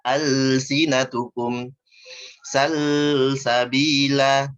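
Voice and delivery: alsinatukum salsabila with the lam held far too long; wrong: lam stretched on and on; overlong lam. Shown as a demonstration. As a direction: drag out the l in salsabila, very slowly.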